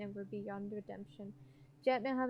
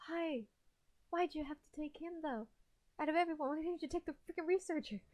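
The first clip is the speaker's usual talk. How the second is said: higher, pleading complaint; upset protest; 6 semitones higher than usual. Real, not acted. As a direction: demand an answer from the game. Complaining to the game about death.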